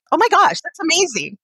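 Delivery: high, loud burst of delight; excited. Real, not acted. Guest's bucket list news.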